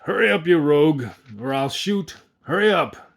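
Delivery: an angry mayor yelling into the dark; coarse shout. Acted. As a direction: bark it down the hole like a threat. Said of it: "in a coarse voice".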